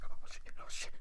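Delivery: whispered oh shit; hushed stunned whisper. Retold or imitated. Mocking a frat guy reading her ID, whispered. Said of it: "pulls it out and is like".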